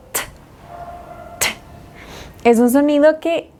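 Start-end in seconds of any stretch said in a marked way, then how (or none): 0.1-1.5 an isolated T, a puff of air without voice; tongue-tap "t" released as pure air; crisp T sound. Shown as a demonstration. Teacher showing learners the first T sound, voiceless T. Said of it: "el primer sonido es".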